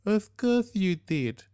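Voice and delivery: wife mockingly agreeing with husband; teasing. A narrator's reading. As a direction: stretch it out playfully, not believing him. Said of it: "Navina teased".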